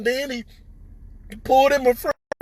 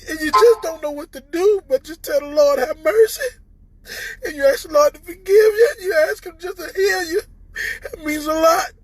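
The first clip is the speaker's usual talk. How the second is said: higher, tearful, voice breaking; real crying; 3 semitones higher than usual. Real, not acted. Telling viewers he faced death, crying openly. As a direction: let the tears break through the words. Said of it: "I didn't mean to get on here and cry".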